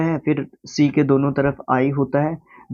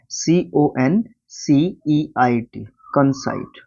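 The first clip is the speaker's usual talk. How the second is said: each letter of सीओएनसीईआईटी separated, slow; slow dictation; much slower than usual. Shown as a demonstration. Teacher dictating a spelling to students, very slow. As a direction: spell कंसाइट out letter by letter, very slowly.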